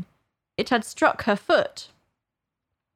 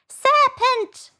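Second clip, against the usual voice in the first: very high, loud shriek; screaming pigeon; 5 dB louder than usual. A narrator's reading. Pigeon attacking Alice, screamed accusation. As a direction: screech it out in a put-on bird voice. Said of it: "screamed the pigeon".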